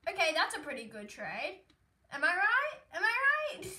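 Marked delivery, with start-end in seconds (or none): 2.1-3.7 excited. Real, not acted. Excited about trade.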